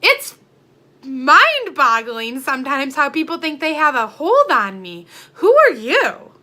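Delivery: incredulous, angry challenge; indignant. Real, not acted. Vlogger challenging people who think they control her, indignant.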